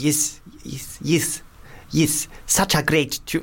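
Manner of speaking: imitating an actor's South African accent. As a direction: find the South African accent on each word. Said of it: "Think about Morgan Freeman in Invictus".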